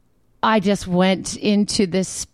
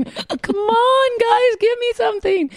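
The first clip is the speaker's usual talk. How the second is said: pleading; slower than usual. Retold or imitated.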